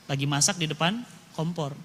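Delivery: ordinary delivery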